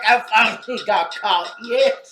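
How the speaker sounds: put-on Southern accent. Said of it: "I keep putting a southern accent on him".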